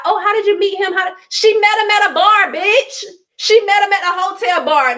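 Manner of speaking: high, incredulous and pushed; can't believe they fall for it; exasperated disbelief. Real, not acted. Mocking fans' question, exasperated.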